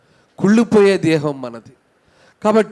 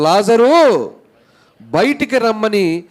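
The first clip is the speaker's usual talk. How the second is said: higher, louder summons on the name; loud call; 6 semitones higher, 3 dB louder, slower than usual. Retold or imitated. Preacher showing how Jesus called Lazarus out, loud call. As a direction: call the name out as if to wake the dead. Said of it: "బిగ్గరగా చెప్పగా".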